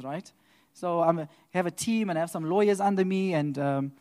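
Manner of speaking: ordinary delivery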